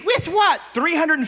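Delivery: put-on high whiny teen; sulky teenage boy. Teen son answers back.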